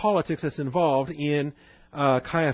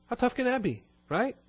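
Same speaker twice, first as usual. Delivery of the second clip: scheming high priest; 6 semitones higher than usual. Retold or imitated. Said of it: "He says".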